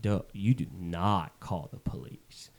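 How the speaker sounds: dopey guy. Retold or imitated. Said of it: "And he's like".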